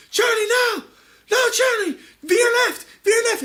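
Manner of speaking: very high, loud screamed warnings; desperate warning shout. Acted. Rescuer warning Charlie as the hippo nears, desperate shouting.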